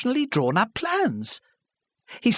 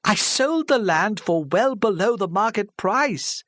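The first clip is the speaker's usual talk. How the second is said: slow, higher helpless admission; 12 semitones higher, 4 dB louder, slower than usual. Acted. An old woman admitting her mistake to the village, helpless. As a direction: plead it, out of options. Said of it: "she said helplessly".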